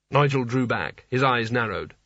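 ordinary delivery, nothing marked

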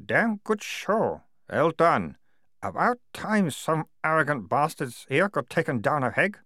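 high-pitched ventriloquist-like captain; barely moving lips. A narrator's reading. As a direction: say it through nearly motionless lips. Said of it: "spoke while trying not to move his lips".

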